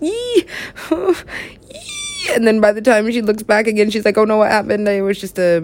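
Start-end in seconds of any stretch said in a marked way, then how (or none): 0.0-2.3 high squealing shriek; squeals of fright. Retold or imitated. Imitating the frightened woman with high squeals. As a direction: squeal like a scared pilot. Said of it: "So she's like".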